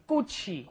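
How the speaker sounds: 꽃이 as "kko-chi" with a tense first k; pronunciation demo. Shown as a demonstration. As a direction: say 꽃이 for students to repeat, ㅊ carried into "chi". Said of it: "Try repeating that one".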